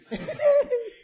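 high, loud joyful laugh; burst of laughter. Real, not acted. Preacher laughing aloud at the joy of praising God.

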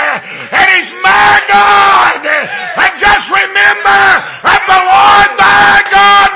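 high, loud, shouted preaching; preacher shouting praise to the congregation; preaching at full force. Real, not acted. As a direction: let it ring out to the rafters.

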